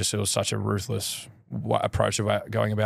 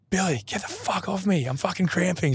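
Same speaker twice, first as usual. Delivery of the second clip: high, strained mock yell at a teammate; 9 semitones higher than usual. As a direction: imitate a cramping player shouting. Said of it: "And then Steele goes".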